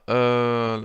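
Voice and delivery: usual speaking voice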